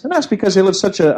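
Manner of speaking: ordinary delivery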